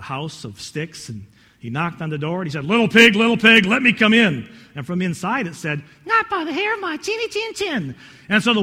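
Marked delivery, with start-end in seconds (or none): 2.6-4.5 loud storybook wolf call; big bad wolf. Wolf calls again. 6.1-7.9 very high squeaky piglet voice; little pig. Acted. Pig answers from inside.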